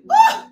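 loud high-pitched woo; high whoop. Real, not acted. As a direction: let out a joyful whoop. Preacher's exclamation mid-sermon.

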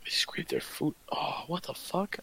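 breathy disgusted whisper. Real, not acted. Disgusted reaction, unvoiced.

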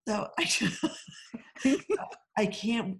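ordinary talk, nothing marked

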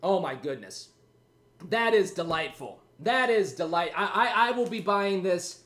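ordinary delivery

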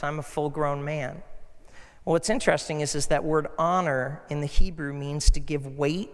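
usual speaking voice

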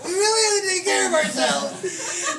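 high, loud, drawn-out mock wail; anxiety and fear voice. Fearful thoughts plead for self-care, hammed-up anxious wail. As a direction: wail it as exaggerated panic. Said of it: "That thing is all anxiety, all fear".

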